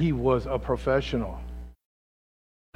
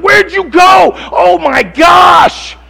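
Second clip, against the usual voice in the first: high, loud, slowing panicked cry; frantic shout for Jesus; 20 dB louder, much slower than usual. Acted. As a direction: yell it out lost in the waves. Acting Peter lost in storm.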